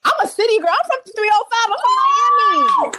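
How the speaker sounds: high hometown boast, building to a shout; proud shout-out. Real, not acted. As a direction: rep your city, last word stretched and shrieked. Proudly claims her home city.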